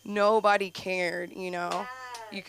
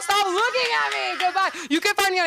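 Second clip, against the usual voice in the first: high, loud mock diva shriek; 10 semitones higher, 5 dB louder than usual. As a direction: push them off like a diva.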